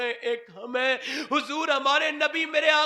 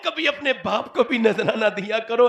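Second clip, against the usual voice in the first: fiery preaching; 6 semitones higher, 6 dB louder than usual. Acted. Preaching at full force.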